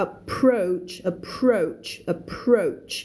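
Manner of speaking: "approach" repeated slowly, the r in "proach" stressed; clear R. Shown as a demonstration. Teacher reminding learners of the R, "approach" slowly articulated. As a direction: say "approach" as "a-PROACH", r fully sounded. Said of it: "อย่าลืมตัว R นะ".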